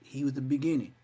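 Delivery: usual speaking voice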